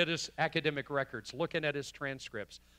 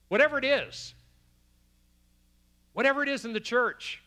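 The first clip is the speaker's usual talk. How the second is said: raised pitch, loud sermon delivery; forceful preaching; 9 semitones higher, 7 dB louder than usual. Real, not acted. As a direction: drive it home to the congregation, loud and high.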